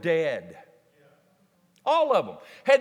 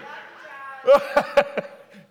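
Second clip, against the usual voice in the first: loud laughing shout; 6 semitones higher, 6 dB louder than usual. Real, not acted. Laughing loudly after teasing Mary.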